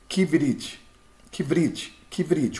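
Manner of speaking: kivrit, read fast, shva barely there; quick reading. Shown as a demonstration. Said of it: "como se fosse lendo rápido". (kivrit lido rápido)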